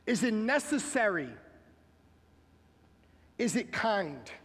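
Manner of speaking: loud, high, emphatic sermon delivery; fiery pulpit preaching, every line driven home; full-force preaching. Acted. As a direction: preach it out to the whole room, pounding each point.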